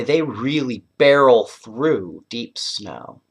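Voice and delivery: usual speaking voice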